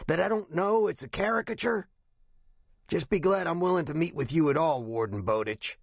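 high, scratchy voice, taking umbrage; offended captain. A narrator's reading. Captain scolds the investigator over the phone, offended raspy voice. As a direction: put the caller in his place, high and raspy.

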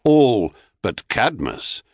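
ordinary delivery

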